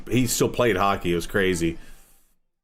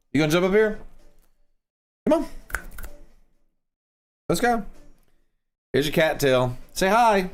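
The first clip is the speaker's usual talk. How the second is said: pet voice; 7 semitones higher than usual. Real, not acted. Talking to the cat.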